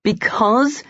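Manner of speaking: disbelieving echo; incredulous. Acted. Colin echoes in disbelief.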